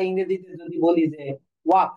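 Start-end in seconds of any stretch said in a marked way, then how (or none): 1.7-1.9 English walk, ওয়াক, with no L sound; short ওয়াক, the L of walk dropped; silent L. Shown as a demonstration. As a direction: say ওয়াক as "wak", leaving the L silent. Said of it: "এখানে এল এর উচ্চারণ হচ্ছে না".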